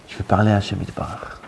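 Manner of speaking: usual speaking voice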